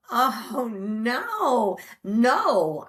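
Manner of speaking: mock wail of dismay; retold dismay. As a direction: protest as you are thrown out. Retelling dismay at being sent back.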